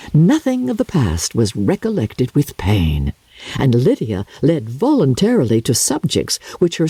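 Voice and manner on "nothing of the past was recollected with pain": usual speaking voice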